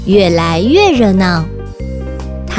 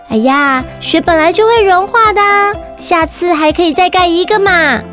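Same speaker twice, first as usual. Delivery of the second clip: big sister voice; 7 semitones higher than usual. Acted. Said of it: "姐姐说".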